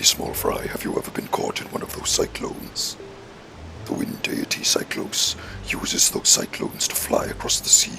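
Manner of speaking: deep, voiceless villain whisper; low breath-heavy villain rasp. Acted. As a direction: read it aloud in a soft, husky sinister overlord voice.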